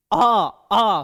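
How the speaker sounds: आ sounded long and loud from the throat; vowel demo. Shown as a demonstration. Teacher demonstrating the throat sound आ to pupils, loud and drawn. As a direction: push out आ from the throat, loud and open. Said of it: "कहां से निकल रहा?".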